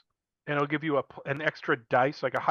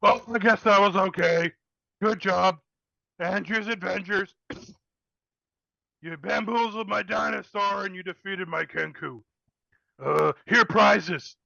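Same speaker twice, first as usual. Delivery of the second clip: higher, louder booming ogre voice; 5 semitones higher, 3 dB louder than usual. Acted. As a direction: boom it out like a dim ogre. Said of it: "D J Ogre's voice goes".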